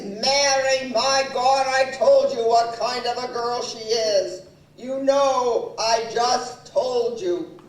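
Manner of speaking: loud, emphatic mimicry of a fed-up husband; imitated husband laying down the law; acted exasperated husband. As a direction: play his indignant outburst, loudly. Retelling husband's angry outburst.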